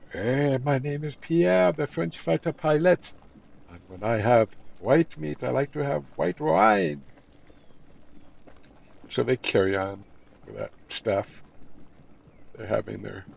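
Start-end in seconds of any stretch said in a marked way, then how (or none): 0.1-7.0 higher, slowed, lightly voiced proud pilot; smug Pierre. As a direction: say it like a self-satisfied charmer. Pierre explains again.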